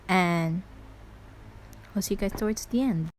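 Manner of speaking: loud showman's announcement. Real, not acted. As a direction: boom it out to ladies and gentlemen. Addresses viewers, announcing a skip.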